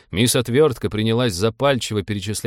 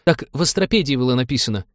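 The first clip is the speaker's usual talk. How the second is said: higher-pitched justifying voice; self-justifying, pitched up; defensive excuse; 5 semitones higher, faster than usual. A narrator's reading. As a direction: plead that it wasn't your fault. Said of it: "привычно начал оправдываться навигатор".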